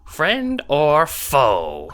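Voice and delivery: high, loud, drawn-out challenge; demanding Dursite. A narrator's reading. Short Dursite challenging intruders, demanding. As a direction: challenge them in a high, slow little voice. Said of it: "demands the short Dursite".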